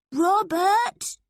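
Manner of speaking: high, loud call into a dark room; boy calling into the dark room, loud. A narrator's reading. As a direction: call his name into the dark. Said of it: "called Toby into the dark room".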